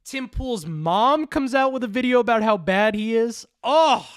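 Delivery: high-pitched, thrilled outburst; gleeful excitement. Real, not acted. Commentator imagining Tim Pool's mom coming out, gleeful. Relishing an imagined next scandal.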